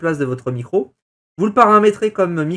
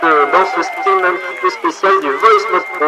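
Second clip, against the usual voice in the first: processed voice-changer tone; Spitfire voice filter; 3 dB louder than usual. Shown as a demonstration. Streamer demoing the Spitfire filter to his viewers. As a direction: announce the innovation through a new filter. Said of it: "La voici".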